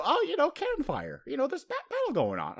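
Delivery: high, put-on innocent voice; feigned excuse. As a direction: play dumb with a lifted pitch.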